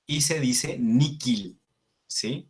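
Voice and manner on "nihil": Nikil said "NEE-keel", h as k; Nikil, k for the written h; Latin pronunciation. Shown as a demonstration. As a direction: say Nikil slowly with a clear k. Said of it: "la H se pronuncia como una K".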